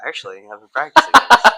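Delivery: loud, put-on proud shark. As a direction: boast it like a shark showing off. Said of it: "He's like".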